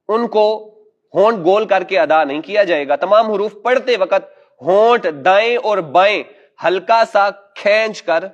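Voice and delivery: ordinary talk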